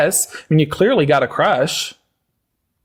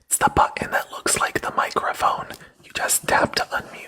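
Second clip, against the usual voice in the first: condescending whisper; 8 dB quieter than usual. Acted. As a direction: whisper it like to a child. Condescending whisper after plain take.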